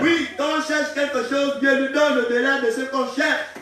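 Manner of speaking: loud, high declaration of blessing; forceful preaching. Real, not acted. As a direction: proclaim it boldly to the congregation.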